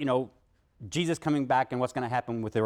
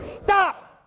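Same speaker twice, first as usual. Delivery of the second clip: high loud cutoff; sharp shouted stop; 6 dB louder than usual. Real, not acted. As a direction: cut the chant dead. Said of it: "cutting off the chant".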